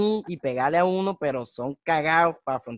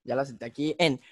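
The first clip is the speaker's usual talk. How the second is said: usual speaking voice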